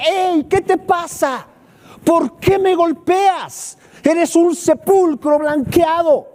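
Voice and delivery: high, slow, indignant rebuke; Paul turning on the man who struck him; Paul angrily rebuking the one who hit him; angry rebuke. Acted. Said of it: "Pablo se enoja tanto".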